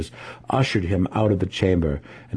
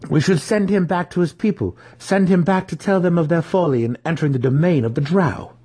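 a touch higher, earnest plea; lightly voiced young drow; 9 semitones higher than usual. Drizzt urging his kin to send the gnome home, earnest plea. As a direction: press the case gently.

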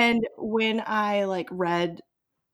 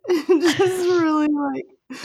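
breaking into high laughter; helpless laughing, high and loud; 9 semitones higher, 5 dB louder, slower than usual. Real, not acted. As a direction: laugh through the words, pitch jumping up.

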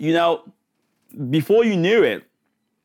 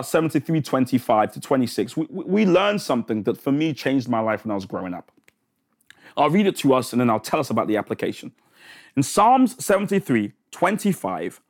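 usual speaking voice

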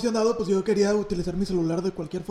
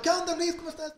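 bright, higher eager hello; excited greeting; 8 semitones higher, 5 dB quieter than usual. Retold or imitated. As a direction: answer the phone all thrilled. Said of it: "yo emocionado".

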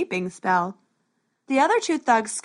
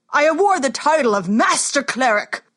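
loud, higher royal proclamation; booming warrior king; 4 semitones higher, 6 dB louder than usual. Acted. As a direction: award it grandly to the crowd. King awards title.

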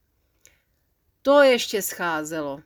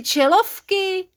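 a slow, higher questioning Čelovky; dwarves asking; 10 semitones higher, 4 dB louder, much slower than usual. Acted. The dwarves quiz the canoeists, slow and doubtful. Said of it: "Pokračovali žifulíci".

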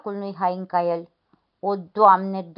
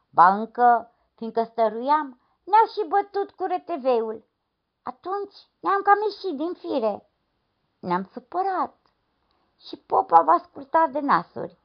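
aggrieved peasant; 9 semitones higher than usual. Acted. Noseless man complains to lad.

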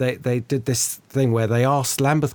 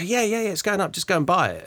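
insistent call; 7 semitones higher than usual. Retold or imitated. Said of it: "They go".

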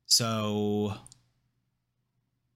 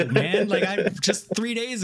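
louder, higher exasperated cry; venting at Mark, louder exasperated cry; 9 semitones higher, 3 dB louder, slower than usual. Retold or imitated. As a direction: throw up your hands at the character. Exasperated rant about Mark.

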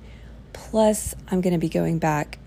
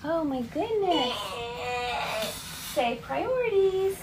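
exaggerated cartoon intonation; 7 semitones higher, 4 dB quieter than usual. Real, not acted. Mother coaxing her baby.

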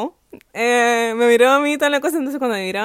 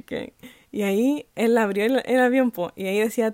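normal talking voice

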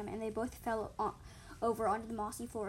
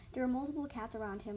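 usual speaking voice

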